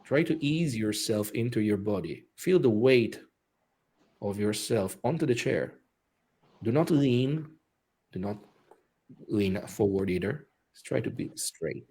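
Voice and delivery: guided meditation. Acted. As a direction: ease them into breathing. Leads breathing meditation.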